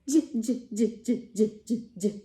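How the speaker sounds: j repeated as a bouncy jiggling sound; j, j, j like a laughing jellyfish; J sound for kids; letter J sound. Shown as a demonstration.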